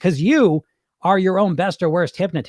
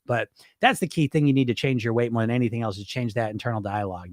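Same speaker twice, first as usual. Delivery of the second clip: ordinary talk, nothing marked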